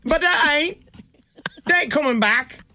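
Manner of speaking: high mock Cockney staff voice. Palace staff brushing off Harry, high mock Cockney. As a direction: brush him off in a Cockney accent. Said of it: "Why are they Cockney now?".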